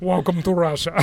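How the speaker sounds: imitating the guide's Russian accent, amused. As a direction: repeat the catchphrase in a mock Russian accent. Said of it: "Yes, exactly like that".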